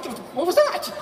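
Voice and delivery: high, loud, laughing at himself; shocked at what came out of your mouth; laughing disbelief. Retold or imitated. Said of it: "You're like".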